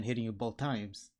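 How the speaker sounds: normal talking voice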